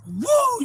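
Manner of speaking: a very high, loud whoop; whooping cheer. Real, not acted. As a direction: let out a victory whoop. Celebrating the monster bass.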